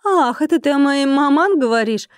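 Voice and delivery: breezy haughty woman's question; offhand. A narrator's reading. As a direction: toss it off carelessly. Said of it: "небрежно обронила женщина".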